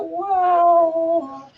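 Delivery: creature voice. Acted. GM voicing Gribblegast.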